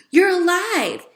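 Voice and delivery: high, loud joyous exclamation; delighted surprise. A narrator's reading. Boy finding his mouse alive, delighted. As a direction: burst out in relief.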